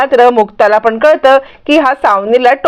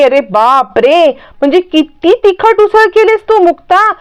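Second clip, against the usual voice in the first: startled by spice; 6 semitones higher than usual. Retold or imitated. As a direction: react like the food burns your mouth. Madhavi tastes very spicy usal. (तिखटाने चटका बसल्यासारखा उद्गार)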